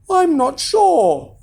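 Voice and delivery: high, slow, hesitant wife; giant's wife, drawn-out doubt; doubtful wife. A narrator's reading. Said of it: "she said".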